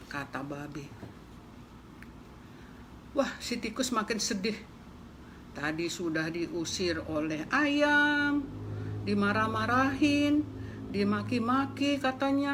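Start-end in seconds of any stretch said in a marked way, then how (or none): none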